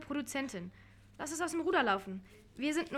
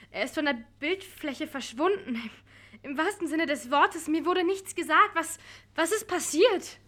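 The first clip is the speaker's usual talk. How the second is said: louder, higher, urgent; alarmed; 5 semitones higher, 8 dB louder than usual. Acted. Producer demanding what happened to Andreas, alarmed. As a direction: demand the truth, rising panic.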